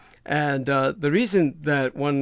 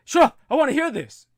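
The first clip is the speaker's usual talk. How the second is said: high, louder impersonation of an awed listener; eager mock exclamation; 12 semitones higher, 5 dB louder than usual. Mocking Americans who hear a British voice on radio, mock-eager exclamation. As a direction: jump up in pitch, mimicking sudden eagerness.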